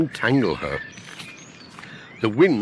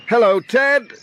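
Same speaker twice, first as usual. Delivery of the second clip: loud, high hello across the yard; calling out; 11 semitones higher, 5 dB louder than usual. A narrator's reading. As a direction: call out for Ted. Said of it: "shouted Pat".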